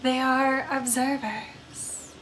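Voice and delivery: slow, soft, mournful vampire voice; a lonely undead woman drifting through her sorrows; brooding vampire ghost. Acted. Vampire ghost confiding in a lonely spirit, slow and hushed.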